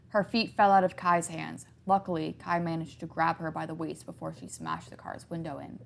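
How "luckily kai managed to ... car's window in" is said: plain reading voice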